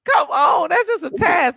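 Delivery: exasperated, high-pitched protest; real exasperation. Real, not acted. Heated rant about gendered household chores.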